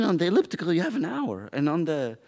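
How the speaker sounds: ordinary talk, nothing marked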